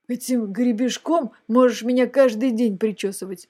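usual speaking voice

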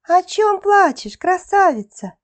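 high little bird voice; cartoon bird voice. A narrator's reading. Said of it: "чирикнула синичка". (птичий голосок, высоко)

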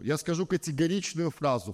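usual speaking voice

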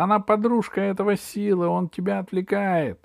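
high-pitched witch tattling; shouting witch. A narrator's reading. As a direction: denounce the girl in a high voice. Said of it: "закричала ведьма". (ведьма ябедничает высоким голосом)

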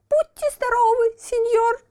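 put-on high tearful puppet boy; sobbing puppet boy. A narrator's reading. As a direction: sob out a polite blessing. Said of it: "всхлипнул Буратино".

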